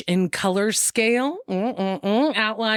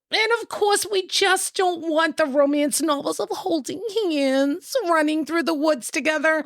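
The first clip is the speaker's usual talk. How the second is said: high, sing-song mockery; mock the sappy romance in a high voice; 11 semitones higher than usual. Mocking sweet romance novels.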